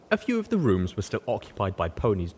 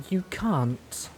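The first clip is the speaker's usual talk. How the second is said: disappointed; 11 semitones higher than usual. A narrator's reading. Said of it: "Glory started to say in disappointment".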